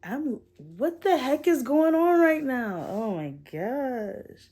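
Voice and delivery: high, drawn-out tearful bewilderment; slow wail that fades on the last words; real tearful overwhelm, voice climbing then dropping; starting to cry. Real, not acted. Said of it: "I definitely just cried".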